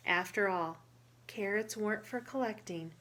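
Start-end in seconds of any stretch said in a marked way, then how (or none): none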